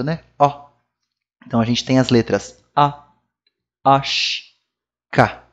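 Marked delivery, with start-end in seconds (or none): 0.4-0.7 Ó said slightly more closed and nasal; a touch more closed vowel; French closed a. Shown as a demonstration. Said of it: "Um pouquinho mais fechado".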